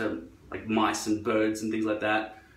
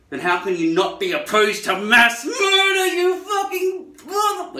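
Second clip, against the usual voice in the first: loud, high, building to a drawn-out scream; outraged rant; 6 dB louder than usual. Pretend. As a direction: let the mock fury climb into a screech.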